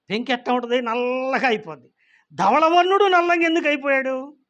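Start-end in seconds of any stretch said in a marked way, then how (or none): 2.3-4.4 higher and louder, pressing the question. Real, not acted. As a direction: hit నల్లంగా hard and high. Question repeated, first loud.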